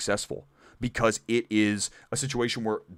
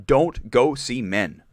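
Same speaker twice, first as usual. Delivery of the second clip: each word of "Don't go see Men" slow, heavy and louder; slow stressed warning; 2 semitones higher, 4 dB louder, much slower than usual. Real, not acted. Pleading with viewers to skip Men, slow and emphatic. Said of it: "I can't stress this enough".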